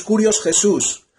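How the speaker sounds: ordinary delivery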